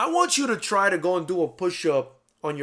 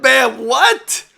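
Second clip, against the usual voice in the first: high, loud stunned cry of disbelief; shocked disbelief; 10 semitones higher, 12 dB louder than usual. Retold or imitated. Telling a friend about Bam, loud shocked yelp. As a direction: yelp it like you can't believe your ears. Stunned reaction to Bam's story.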